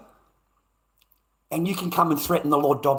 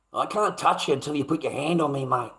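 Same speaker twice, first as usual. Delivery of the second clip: ordinary talk, nothing marked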